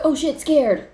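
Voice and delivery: high, loud mock scare; startled fright. Showing a costume scare, startled fright. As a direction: burst out as if startled. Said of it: "My body would have been like".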